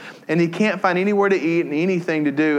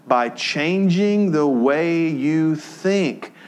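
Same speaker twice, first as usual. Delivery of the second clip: slow emphasis; 2 semitones higher, much slower than usual. Real, not acted. Slow emphatic sermon point.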